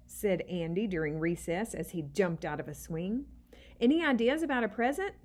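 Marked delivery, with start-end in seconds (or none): 3.8-5.1 eager question. A narrator's reading. Eddie asks eagerly.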